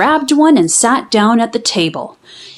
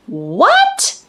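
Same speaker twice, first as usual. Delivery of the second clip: mild yell; 9 semitones higher than usual. A narrator's reading. Girl reacts to her dad's Cupid story, mild startled yell. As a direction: jump at the news with a high "What?". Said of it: "yelled Amelia Bedelia".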